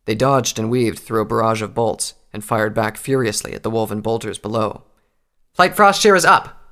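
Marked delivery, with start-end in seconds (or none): 5.5-6.6 high, loud call to the squadron; shouted military announcement; Tailwind calling out. A narrator's reading. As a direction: call it out across a battlefield. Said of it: "Tailwind called out".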